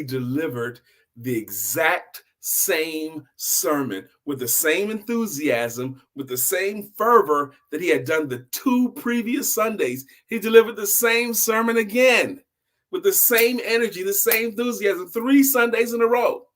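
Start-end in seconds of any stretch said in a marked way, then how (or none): 6.1-16.5 rising, louder and higher; emphatic storytelling. A narrator's reading. Storyteller to audience, building to a loud emphatic peak. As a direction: punch out the repetition with mounting fervor. Storyteller climaxes, shout heard.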